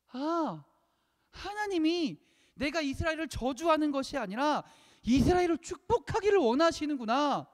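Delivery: high-pitched dawning "aha" cry; acted sudden realization. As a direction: voice Balaam as the truth finally hits him. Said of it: "아, 이제 깨닫게 된 거예요". (문득 깨달은 듯 높은 소리로)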